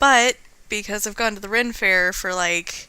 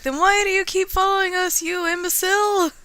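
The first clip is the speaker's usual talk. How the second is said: high, raised indignant retort; yelled back; 7 semitones higher, 4 dB louder than usual. Acted. Captain Allegra answers the rival pirate, yelling back. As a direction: yell it back at a pursuing enemy. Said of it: "Captain Allegra yelled back".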